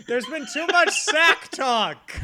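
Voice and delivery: high, loud, drawn-out exclamation; mock outrage. Host protesting all the sack talk, mock-outraged shout. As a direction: cry out in exasperation, half laughing.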